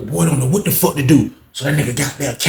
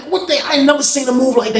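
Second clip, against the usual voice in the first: shocked; 11 semitones higher than usual. Retold or imitated. Shocked at the move.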